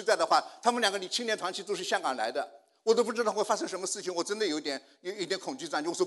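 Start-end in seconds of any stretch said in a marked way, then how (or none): none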